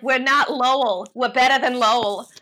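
mocking Boston locals, half-hearted Boston accent; bad Boston accent. Imitating Boston people insisting they're not Lowell, mild Boston accent. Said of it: "sorry for my bad accent".

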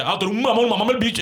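high, sneering mock jeer; mocking insult voice. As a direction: play the jeering hater. Mocking how people insult fans.

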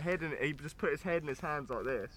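usual speaking voice

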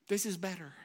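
ordinary delivery

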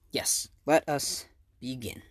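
normal talking voice

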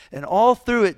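ordinary talk, nothing marked

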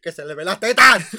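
loud, laughing scolding; mock loud scolding. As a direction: yell it with a laugh. Acted stewardess scolding. (regaño a gritos entre risas)

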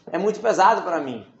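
normal talking voice

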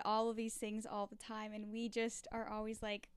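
usual speaking voice

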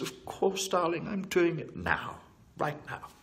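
high-pitched, camp engineer voice; Scotty. Acted. Engineer agrees to boost the shields, high mock-sweet voice. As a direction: answer the captain in a put-on high voice, obligingly. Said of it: "And Scotty says".

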